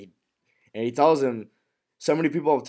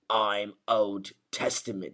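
slow, lower character voice; retold TV character line; 4 semitones lower, 4 dB quieter, slower than usual. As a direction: draw it out slowly and low, lightly in character. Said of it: "stares at him and he goes".

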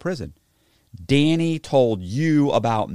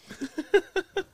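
laughing; 4 dB quieter than usual. Real, not acted. Laughs at his own punchline.

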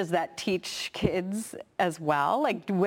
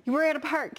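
high, incredulous exasperation; frustrated parent; 7 semitones higher, faster than usual. Retold or imitated. Mother to six-year-old crying at a park, frustrated. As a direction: imitate a parent's disbelief, pitched up. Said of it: "it's frustrating".

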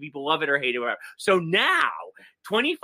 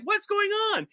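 bewildered; slower than usual. Retold or imitated. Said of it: "everyone's like".

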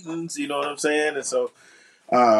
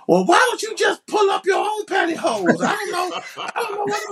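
unmuted background voice. Retold or imitated. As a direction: squawk it like a careless caller. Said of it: "somebody going".

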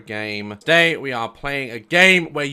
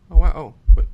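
usual speaking voice

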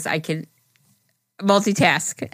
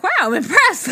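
high-pitched mock amazement; imitated impressed reaction; 12 semitones higher, 4 dB louder than usual. Retelling co-host's praise for her slang, mock-impressed. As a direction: jump up high, playing someone impressed.

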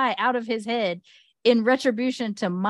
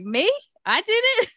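high incredulous squeak; high surprised mock protest; mock disbelief; 12 semitones higher than usual. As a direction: act shocked at being blamed. Aegon voiced, incredulous.

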